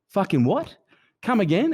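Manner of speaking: retold disbelief, pitch leaping up; high, stunned comeback to the manager; incredulous disbelief. Retelling his shock at being ejected.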